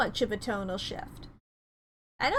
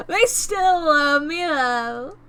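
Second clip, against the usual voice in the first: slower, stretched-out, settling exclamation; drawn-out repeat; 2 semitones higher, 5 dB louder, slower than usual. Real, not acted. Streamer savouring still being loved, drawn-out repeat. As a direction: draw out each word, easing down at the end.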